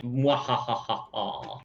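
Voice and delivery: slow villain cackle; drawn-out mock evil laugh. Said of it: "Bros are evil".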